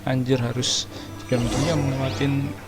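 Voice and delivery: normal talking voice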